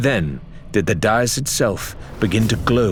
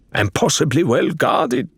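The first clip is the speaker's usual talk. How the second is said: musing; 7 semitones higher than usual. A narrator's reading. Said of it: "Faisal mused".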